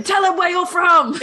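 high, loud mock cheerleading; worst hype man. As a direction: egg them on like a terrible hype man. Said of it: "like the worst hype man in history".

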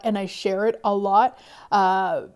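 normal talking voice

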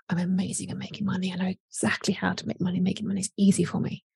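rushed hushed whisper, half to herself; quick whisper. Pretend. Clients whispering affirmations when the phone reminder goes off. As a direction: murmur the affirmations fast under your breath. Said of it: "and they're like".

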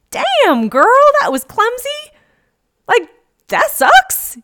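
put-on high, loud sassy girlfriend voice; sassy funny voice. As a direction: play the sassy best friend teasing. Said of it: "bring in a funny voice in my mind".

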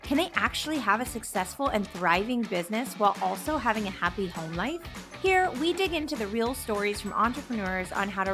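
polished announcer read over music; podcast intro read. Acted. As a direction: read it like a show opener.

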